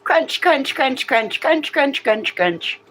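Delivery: very high playful chant; high singsong. Real, not acted. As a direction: tease the dog in a high singsong. Teasing dog with treat.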